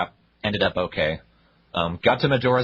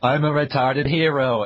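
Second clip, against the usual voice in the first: mock dumb hero; 6 semitones higher, 4 dB louder than usual. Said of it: "The only personality trait of Link is".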